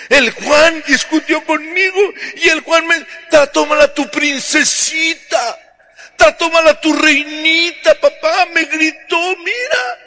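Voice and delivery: put-on very high childish whine; mock bratty princess, high and whiny; tattling daughter. Bride tattles to father.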